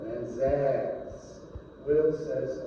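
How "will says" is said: ordinary delivery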